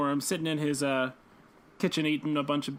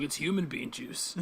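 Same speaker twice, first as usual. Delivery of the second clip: vigilante impression; 3 semitones lower, 5 dB quieter than usual. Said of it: "And he's like".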